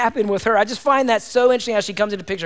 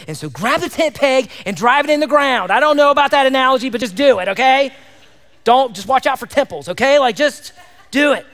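fired-up preaching; 6 semitones higher, 5 dB louder than usual. Acted. Loud preaching, laughing aside.

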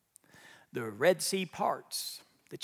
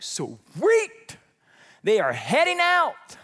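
high, loud, whooping glee; delighted Moses; 6 semitones higher, 8 dB louder, much slower than usual. Acted. Moses hearing Pharaoh release the people, elated. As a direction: celebrate loudly, pitch leaping up.

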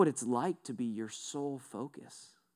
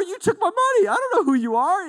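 high, loud, fast rising accusation with don't stressed; hammed-up indignation at being robbed; loud shocked accusation; outraged victim; 9 dB louder, faster than usual. Retold confrontation with a thief.

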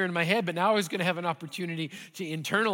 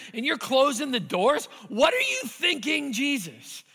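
exasperated protest; 6 semitones higher than usual. Retold or imitated. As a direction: reproach God with rising frustration. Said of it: "I remember saying".

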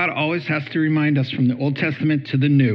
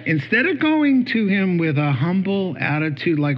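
ordinary talk

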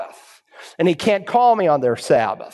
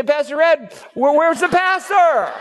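frantic, near shouting; panicked shout; 8 semitones higher than usual. Retold or imitated. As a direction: churchgoer shouting at Pastor Ed in alarm. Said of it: "come up to Pastor Ed and say".

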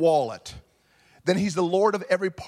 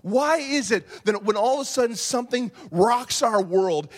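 normal talking voice

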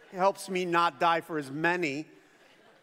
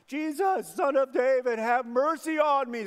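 high, louder desperate cry for mercy; 6 semitones higher, 5 dB louder than usual. Retold or imitated. Blind man by the road crying out to Jesus, loud and pleading. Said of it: "starts to cry out".

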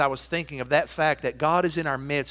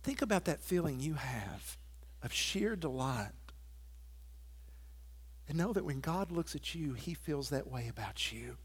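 much softer, intimate sermon voice; tender pastoral hush; 12 dB quieter than usual. Acted. Pastor telling a child of God they are loved, softly. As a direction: lean in and speak it gently to each listener.

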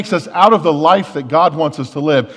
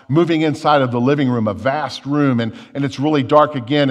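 ordinary talk

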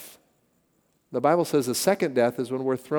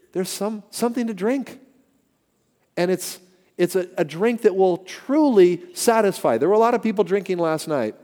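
higher, louder preacher's build; preaching at full force; 7 semitones higher, 6 dB louder than usual. Acted. Pastor urging the congregation, preaching hard. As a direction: drive the point home to the pews.

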